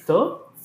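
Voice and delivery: "todo" rising high at the end; entonación de pregunta. Shown as a demonstration. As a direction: lift "todo" into a question. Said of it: "si va hacia arriba, la entonación es pregunta". ("todo" con entonación ascendente de pregunta)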